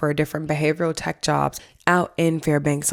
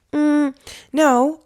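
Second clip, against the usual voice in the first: surprised denial; 11 semitones higher, 6 dB louder than usual. Retold or imitated. Denying changes to the clerk.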